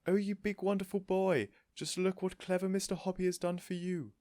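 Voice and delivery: high, loud gushing praise; doting owner praising her pet; delighted cooing. A narrator's reading. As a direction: fuss over the tortoise. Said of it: "she cried, stroking the tortoise".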